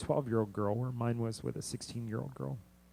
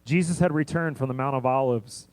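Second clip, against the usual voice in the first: louder, driving sermon voice; preaching at full force; 6 dB louder than usual. Acted. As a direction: push the message out to the congregation.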